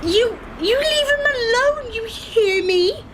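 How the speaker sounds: high, pleading-angry demand; angry warning. Acted. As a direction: drive him off, voice up high.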